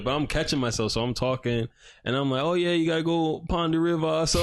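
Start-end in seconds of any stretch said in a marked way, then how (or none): 2.4-4.2 higher-pitched slip into Jamaican patois; lightly borrowed Jamaican accent; picked-up Jamaican accent. Retold or imitated. Speaker recalls unconsciously copying the other person's accent, imitated Jamaican accent. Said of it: "pick up whatever accent they're saying".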